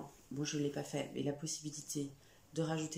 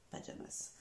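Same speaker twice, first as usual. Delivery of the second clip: whispered; 8 dB quieter than usual. Shown as a demonstration. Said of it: "vous admirez mon accent toujours".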